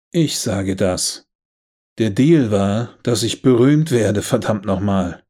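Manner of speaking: slow, loud, spitting each word; furious shout. Acted. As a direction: hammer home the broken deal. Elizabeth shouts her grievance.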